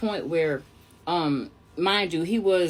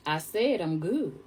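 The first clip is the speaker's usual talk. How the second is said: slow, softer, deliberate refusal; firm refusal; 3 semitones lower, 5 dB quieter, slower than usual. Retold or imitated. Woman throwing the coat off, slow firm refusal. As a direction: say it slowly and quietly, as a final word.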